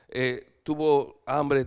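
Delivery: ordinary talk, nothing marked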